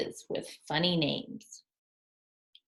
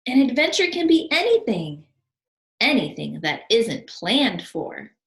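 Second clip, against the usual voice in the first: higher, louder, then slowing on planned; excited Albert; 5 semitones higher, 8 dB louder than usual. Acted. Albert explaining adventures to Wallace, eager and louder. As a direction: sell the idea eagerly.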